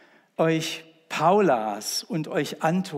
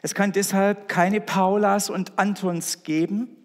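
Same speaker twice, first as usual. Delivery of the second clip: louder, higher, rising to the climax; preacher driving home the point; preaching at full force; 4 semitones higher, 3 dB louder than usual. Acted. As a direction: proclaim it to the whole church.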